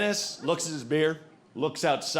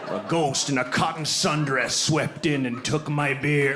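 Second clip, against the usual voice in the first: put-on old drunk's tale. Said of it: "he probably tells everyone he meets in that bar".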